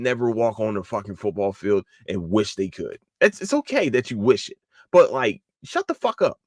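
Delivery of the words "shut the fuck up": high, pinched shut the fuck up; high exasperated shut up. Real, not acted. Vlogger fed up with critics, high exasperated shut up. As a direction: snap it at them.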